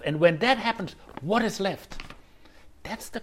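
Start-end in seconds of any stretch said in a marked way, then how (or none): none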